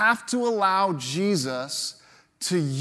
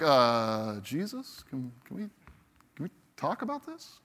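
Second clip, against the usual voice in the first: soft, hesitant, backing off; hesitant plea; 6 semitones lower, 7 dB quieter than usual. Retold or imitated. Disciples nervously pleading with Jesus, softly. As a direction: nervously stall for time.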